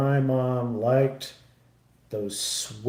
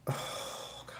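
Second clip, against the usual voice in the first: breathy, almost silent whisper; whispered oh God; 16 dB quieter than usual. Real, not acted. Streamer whispering, overwhelmed by the sad movie. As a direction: let it slip out under your breath.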